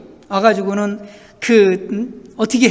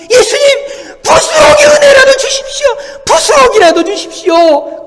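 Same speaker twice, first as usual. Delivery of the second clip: loud, high-pitched begging cry; fervent pleading; 8 dB louder than usual. Acted. Woman begging Jesus for even crumbs of grace, fervent shout. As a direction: cry out for it with full force. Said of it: "그렇게 부탁을 하는".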